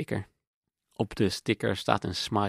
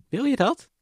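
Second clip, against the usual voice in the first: high and louder, taken aback; astonished question; 14 semitones higher, 6 dB louder than usual. Acted. Girlfriend hearing his request, astonished and high. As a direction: voice jumping up in disbelief.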